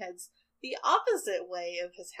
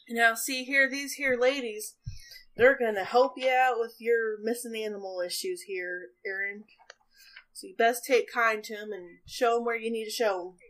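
higher, louder backwoods twang; 3 semitones higher, 6 dB louder than usual. Acted. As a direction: introduce the ladies like a country elder. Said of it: "He goes".

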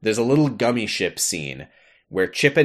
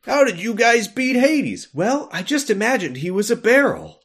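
mock Sora voice; 11 semitones higher than usual. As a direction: play Sora as a naive kid, pitched up. Imagined Sora answering question.

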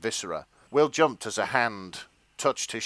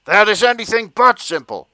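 loud, higher, with a hard push on "but"; shouty police chief; 10 semitones higher, 10 dB louder than usual. Acted. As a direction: fire back like an exasperated boss. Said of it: "police chiefs should sound a little bit like".

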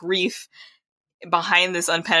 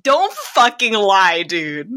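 exasperated scolding; 4 dB louder, slower than usual. Acted. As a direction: call him out slowly and forcefully. Scolding the character for lying.

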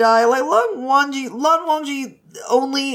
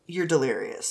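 flat stoic reply; 8 semitones lower, 8 dB quieter than usual. Retold or imitated. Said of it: "Lan Wangji's like".

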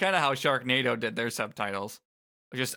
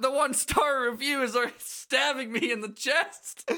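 hammed-up dying anguish; mock agony; 9 semitones higher, 3 dB louder than usual. Joins the mock disaster.

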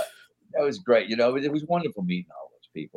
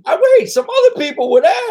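high, loud panicked protest; panicked backpedal; 12 dB louder than usual. Retold or imitated. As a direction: scramble to take it back. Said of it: "I was like".

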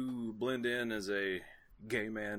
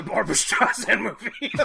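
laughing; 12 semitones higher, 13 dB louder than usual. Real, not acted. Joke ends in big laugh.

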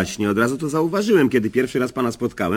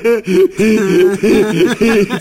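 hearty laugh at own joke; 7 semitones higher, 6 dB louder than usual. Real, not acted.

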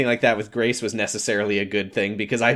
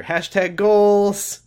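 sarcastic; 7 semitones higher, much slower than usual. Real, not acted. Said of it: "sarcastic slow aside".

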